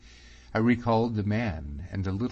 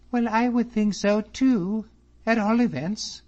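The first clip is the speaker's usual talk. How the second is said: put-on high, bright-eyed girl's voice, louder; young lady's voice; 7 dB louder than usual. A narrator's reading. Miss Drummond chatting to David about the ladies, high and lively. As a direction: voice her a lot higher than the narrator. Teasing reply.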